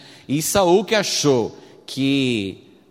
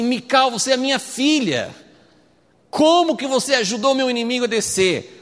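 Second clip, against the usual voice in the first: raised pitch, indignant retold reproach; angry Saul; 8 semitones higher than usual. Saul confronts Mical for helping his enemy, acted angry. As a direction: play an angry father confronting his daughter. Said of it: "Saul fica bravo".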